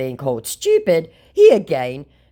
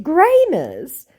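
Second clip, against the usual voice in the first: incredulous; 6 dB louder than usual. Retold or imitated. Incredulous echo.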